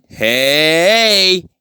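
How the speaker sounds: long, high sing-out greeting; villain's high, drawn-out hail; drawn-out call. Retold or imitated. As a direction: call out and stretch the word. Villain calls out on arrival.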